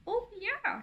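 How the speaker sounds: high call without much volume; lightly acted, high-pitched shout. A narrator's reading. Uncle Tunde joining the fun, mild shout. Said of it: "shouts Uncle Tunde".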